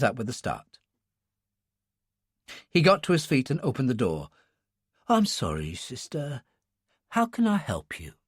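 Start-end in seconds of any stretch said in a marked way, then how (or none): 5.1-8.1 put-on soft, hushed old attendant; a sleepy old man whispering politely; quiet old man voice.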